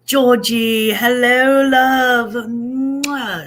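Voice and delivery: a cartoonish British accent greeting Georgie; bad British accent.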